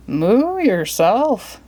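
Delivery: slow drawn-out moo voice; cheeky cow. Acted. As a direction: moo it back, sassy. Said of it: "the cow answered".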